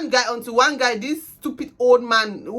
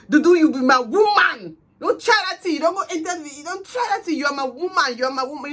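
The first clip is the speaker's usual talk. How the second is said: imitating the ex-boyfriend; 5 semitones higher than usual. The ex-boyfriend claiming her as his woman, in an insistent mock voice. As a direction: play him staking his claim on her. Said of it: "he started saying".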